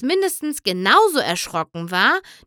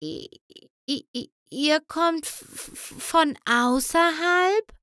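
timid trembling voice; 7 semitones higher than usual. A narrator's reading. The shy pony timidly asks if they come from outside. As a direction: barely dare to ask. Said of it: "Sie sprach so sanft, dass ihre zittrige Stimme".

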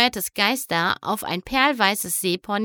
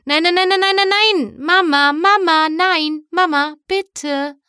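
much higher, louder desperate wail; wailing plea; 9 semitones higher, 7 dB louder than usual. A narrator's reading. Skystar begs her mother to spare her friends, wailing. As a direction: beg mama to stop the guards. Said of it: "jammerte Prinzessin Skystar".

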